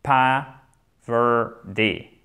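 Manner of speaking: very slow "Poverty" with the t almost a d; slow teacher model of "Poverty"; slow model word. Shown as a demonstration. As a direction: draw out "Poverty", softening the t to d. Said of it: "le te se prononce presque comme un de".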